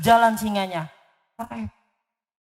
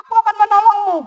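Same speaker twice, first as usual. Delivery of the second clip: much higher eager little mouse; 10 semitones higher, 3 dB louder, faster than usual. Acted. Mouse promises the trapped lion help, high and eager. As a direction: chirp it like a brave tiny mouse.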